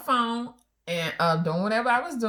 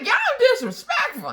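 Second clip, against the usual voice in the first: much higher, louder, laughing; mock indignant; 7 dB louder than usual. Wife accuses husband and woman of disrespect, much higher, louder, laughing. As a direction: accuse them both. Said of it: "I was like".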